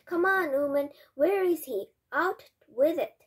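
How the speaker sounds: loud, high, impatient shouting; agitated demand. A narrator's reading. Father demands his son from the grandmother, agitated shout. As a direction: demand an answer, loud and worked up. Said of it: "jumping up and down like a crazy as he spoke".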